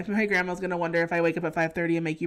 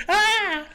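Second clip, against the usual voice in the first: a short high mock scream, louder and higher; 11 semitones higher, 6 dB louder than usual. Friend retelling a reality-show confessional, mock scream. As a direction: reenact the confessional scream in fun.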